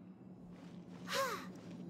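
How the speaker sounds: weary sigh. Acted. Paimon sighs about the license.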